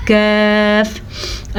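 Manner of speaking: कैफ said thin with a light front k; light kaf. Shown as a demonstration. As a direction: keep कैफ thin and soft. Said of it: "इसको हम पढ़ते हैं कैफ". (बारीक काफ)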